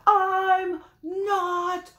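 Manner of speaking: high, loud, slow sing-song yell; furious grumpy monkey shout. Acted. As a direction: bellow it at everyone. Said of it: "shouted Jim".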